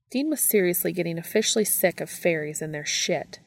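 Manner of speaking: ordinary delivery, nothing marked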